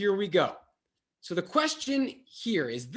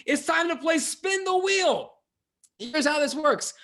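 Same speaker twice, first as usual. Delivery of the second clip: game-show announcement; 9 semitones higher, 4 dB louder than usual. Acted.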